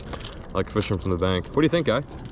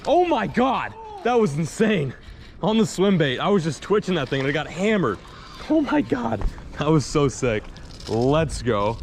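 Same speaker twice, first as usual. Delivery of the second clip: high, shaky with excitement; excited after a sudden strike; adrenaline rush; 5 semitones higher than usual. Real, not acted. Fish strikes the swim bait.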